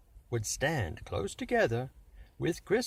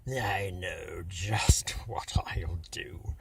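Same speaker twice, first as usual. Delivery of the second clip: slow, breathy throaty villain whisper; laughing in his throat; 5 semitones lower, slower than usual. A narrator's reading. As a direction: savor the evil plan in a husky hush. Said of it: "the Grinch laughed in his throat".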